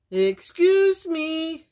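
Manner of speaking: high, slow sing-song call; drawn-out, coaxing wolf call; sweet calling. A narrator's reading. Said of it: "he called sweetly".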